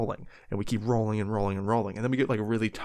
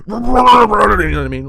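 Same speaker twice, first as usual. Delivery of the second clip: higher and much louder, frantic; frantic excitement; 11 semitones higher, 17 dB louder than usual. Acted. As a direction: burst out wildly. Acting out frantic trash digging.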